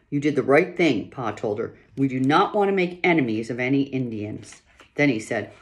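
lively read-aloud voice with characters; story narration. A narrator's reading. Reader performing a pioneer family chapter, storytelling voice. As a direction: read the chapter to listeners with feeling.

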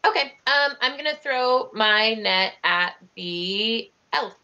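ordinary talk, nothing marked

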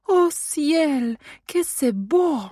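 high, slow gasp of wonder in French; girl lifting tissue paper, crying out in awe; delighted exclamation. A narrator's reading. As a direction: marvel at the gift like an enchanted little girl. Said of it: "zawołała tylko".

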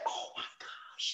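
breathless slow whisper; awed whisper. Retold or imitated. Single mom awed by a free meal, whispering. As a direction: whisper it in amazement.

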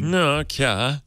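Swedish Chef. Acted. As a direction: say it in a sing-song fake Swedish accent. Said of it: "Say it as a Swedish chef".

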